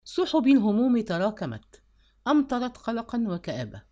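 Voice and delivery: ordinary delivery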